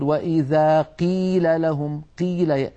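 slow recitation, "قيل" thinned almost to a kaf; over-thinned qaf. Shown as a demonstration. Teacher mimicking faulty reciters, over-thinned qaf. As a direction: make the ق of "قيل" very light, k-like. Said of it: "يبالغون في ترقيق أو في".

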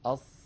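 أص with the sound trapped; sad demo. Shown as a demonstration. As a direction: show أص again. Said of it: "جربوا هكذا". (أص بإطباق)

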